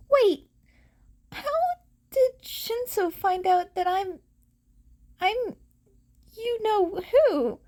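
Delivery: high, sudden alarmed asking; startled question. Acted. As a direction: blurt it out surprised.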